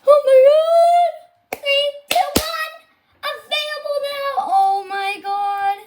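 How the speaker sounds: high-pitched, thrilled countdown cry; excited squeal. Real, not acted. Streamer sees the update become available, excited squeal. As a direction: squeal as the moment arrives.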